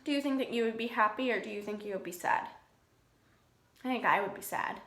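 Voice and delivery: usual speaking voice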